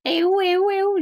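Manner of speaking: very high, loud excited chant; high-pitched kid's cheering, loud; excited child imitation. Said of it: "puis elle fait comme".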